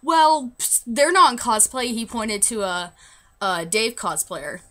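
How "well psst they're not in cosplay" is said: indignant protest with a punched, loud "well"; retold frustrated comeback, pitched up; frustrated retort. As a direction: imitate an aggravated guy, higher and louder. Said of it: "getting really frustrated and aggravated".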